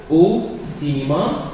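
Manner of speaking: normal talking voice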